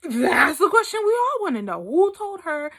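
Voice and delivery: high, loud, outraged soul; exasperated soul. Acted. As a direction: burst out in mock exasperation. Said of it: "And my soul was like".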